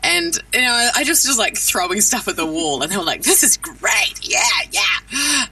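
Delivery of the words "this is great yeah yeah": higher-pitched, lightly hammed-up enthusiasm; mock excitement. Voice actor quoting game clients who love her riffing, mock excitement. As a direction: imitate delighted clients cheering on the ideas.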